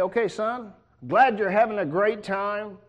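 a loud, easygoing father writing back; lenient dad letter. Pretend. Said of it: "How many of you are gonna say".

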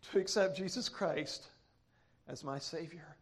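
low, softened preaching voice; solemn testimony. Real, not acted. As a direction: drop low and quiet, confiding a deep conviction. Hushed, solemn testimony to congregation.

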